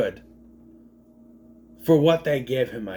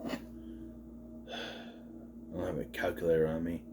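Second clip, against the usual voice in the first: whisper; 8 semitones lower, 12 dB quieter, faster than usual. Real, not acted. Muttering while doing age math.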